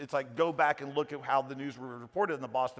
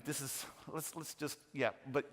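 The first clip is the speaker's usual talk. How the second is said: soft, apologetic mugger murmuring his demands; overly polite mugger; 4 semitones lower, 13 dB quieter than usual. Retold or imitated. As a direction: play a mugger who keeps saying sorry, quietly. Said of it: "You don't get mugged like that unless you're in Canada".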